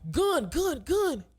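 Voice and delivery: high panicked yell of gun; a high alarmed cry of gun; yelled warning. Retold or imitated. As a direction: shout it like a warning to everyone. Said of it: "yell out".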